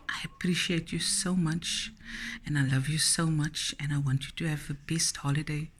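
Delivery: soft, breathless whispered gratitude; whispering thanks. Real, not acted. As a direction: whisper your thanks, moved.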